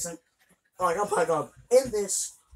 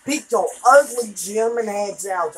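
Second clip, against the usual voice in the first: slow taunt; 3 semitones higher, 8 dB louder, slower than usual. Real, not acted. Taunting enemies in the game.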